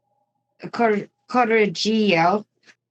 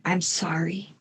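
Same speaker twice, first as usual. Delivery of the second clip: put-on hushed, much softer "I'm sorry", no accent; soft apology; 3 semitones lower, 8 dB quieter than usual. Killer apologizing to her victim, softly. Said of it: "Or should I do that with an Italian accent?".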